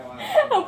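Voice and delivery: very high squealed laugh; real helpless high laugh; shrieking laughter. Real, not acted. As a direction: burst into a high squeal of giggles. Teasing makes speaker laugh.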